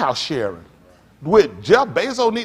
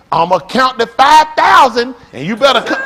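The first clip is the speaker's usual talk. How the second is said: mock parent threat; 5 semitones higher, 7 dB louder than usual. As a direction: warn the kid like a fed-up parent. Parent threatening counting kid.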